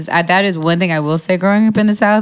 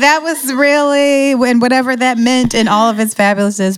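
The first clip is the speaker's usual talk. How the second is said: gushing; 8 semitones higher, 3 dB louder than usual. Real, not acted. Gushing about [name].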